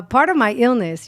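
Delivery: usual speaking voice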